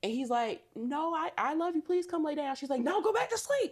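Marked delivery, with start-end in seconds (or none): 0.8-2.6 high-pitched imitation of coaxing; put-on pleading boyfriend; mock pleading. Voices boyfriend pleading with her. 2.8-3.7 very high, insistent imitation; put-on high-pitched insistence; mock upset refusal. Voices girlfriend refusing, upset.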